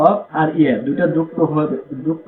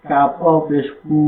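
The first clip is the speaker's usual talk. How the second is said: ordinary talk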